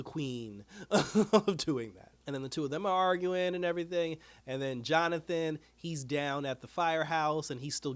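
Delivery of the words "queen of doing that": slow, amused laugh mid-line; laughing through it. Real, not acted. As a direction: laugh at her while saying it. Mocking Sarah, laughing.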